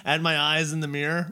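ordinary delivery

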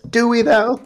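high-pitched questioning doubt; sceptical quip. Retold or imitated. Podcast guy questioning including Cousins, sceptical quip.

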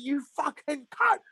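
high, slow furious curse; screaming at phone. Retold or imitated. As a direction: spit it out at the phone. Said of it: "I was going screaming at my phone".

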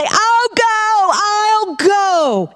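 high, strained cry of yielding; reliving the moment, crying out yes; awed surrender. Retold or imitated. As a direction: give in with a trembling cry. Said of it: "And I say".

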